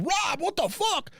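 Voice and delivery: high, shocked "what the fuck"; startled yelp. Retold or imitated. Jolted by Rob's fast car, a high startled yelp. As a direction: yelp it way up at Rob. Car jolts in supercharged mode.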